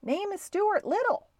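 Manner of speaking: high, carrying shout at modest volume; calling out. A narrator's reading. Stuart giving his name from the boat, calling out. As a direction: call it high to someone on shore. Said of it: "called Stuart at the top of his lungs".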